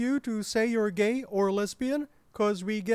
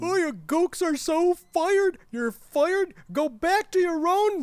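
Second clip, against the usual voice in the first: loud, high-pitched angry shouting; angry outburst; 6 semitones higher, 4 dB louder than usual. Acted. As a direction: explode at staff you are firing. Boss angrily fires the actors.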